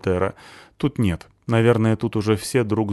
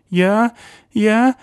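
high, loud frightened stammering; cornered man stammering; panicky stammer; 13 semitones higher, 7 dB louder than usual. A narrator's reading. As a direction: stumble over the words in fear. Stasik stammering.

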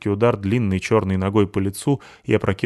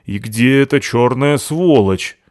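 angry; 4 semitones higher, 7 dB louder, slower than usual. Acted. Натаха очнулась в ярости.

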